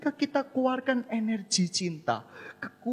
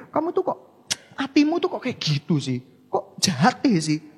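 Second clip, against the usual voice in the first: scolding parent; 2 semitones higher, 7 dB louder than usual. Acted. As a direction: berate the child accusingly. Scolding the child.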